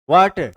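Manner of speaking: "Water" with a loud वा; stress on first syllable. Shown as a demonstration. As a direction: punch the वा of "water", let टर drop. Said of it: "पहले वाले पे ज्यादा लग रहा है".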